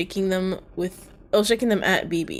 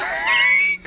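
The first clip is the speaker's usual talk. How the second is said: shrieking laugh; 4 dB louder than usual. Acted. Shocked laughter after remark.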